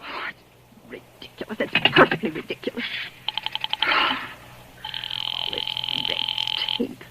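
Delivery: whispered, exasperated aside; muttered to herself. Acted. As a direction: grumble it under her breath. Muttering alone after hanging up.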